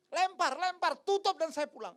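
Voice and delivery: high, loud impatient orders; mock shout. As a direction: hurry the burial with a yell. Ordering to bury fast. (teriak tidak sabar)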